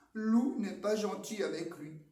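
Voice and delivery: ordinary delivery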